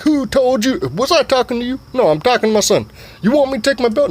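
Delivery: hammed-up high daddy voice; father voice. Dad scolding.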